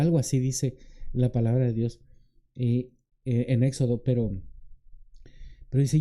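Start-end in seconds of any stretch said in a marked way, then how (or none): none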